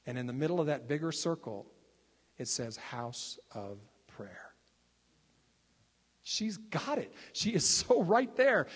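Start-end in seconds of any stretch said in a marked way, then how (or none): 2.8-4.5 slow breathy whisper of the words; awed whisper, slowed right down; hushed reading. Retold or imitated. 6.2-8.7 high, bursting with excitement; real thrill, pitched up and louder; excited. Real, not acted. Excited over woman's insight.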